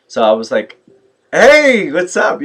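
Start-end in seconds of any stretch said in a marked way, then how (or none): 1.3-2.4 high, loud, drawn-out hello; hearty greeting. Retold or imitated. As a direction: call out to a club acquaintance. Greeting an acquaintance from the clubs.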